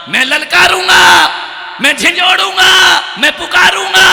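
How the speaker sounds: full-force shouting, high and loud; thundering challenge. Real, not acted. Preacher vows to shake the sleeping audience awake, shouting. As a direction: hurl each phrase like a war cry. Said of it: "मैं कोई कवि नहीं हूँ".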